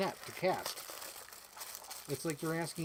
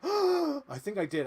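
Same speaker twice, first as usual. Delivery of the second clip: gasp; 11 semitones higher, 5 dB louder, slower than usual. Retold or imitated. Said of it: "I lost my air".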